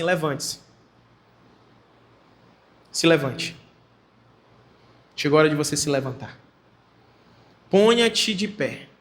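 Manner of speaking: low, unhurried, solemn angel's command; anjo falando. Acted. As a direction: speak as the angel, low, with long pauses. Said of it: "o anjo fala pra ela assim". (voz de anjo, baixa e solene)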